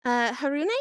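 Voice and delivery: ordinary talk, nothing marked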